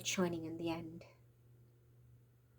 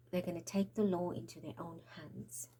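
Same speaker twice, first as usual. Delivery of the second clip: whispering; 7 dB quieter than usual. Real, not acted. Ominous warning to viewers.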